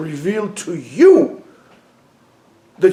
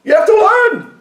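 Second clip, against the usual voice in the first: much higher shouted command; exhortation shout; 4 dB louder than usual. Real, not acted. Rebbe commanding listeners to learn, shouting. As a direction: roar it as a command.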